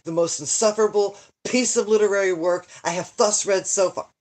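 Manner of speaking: ordinary delivery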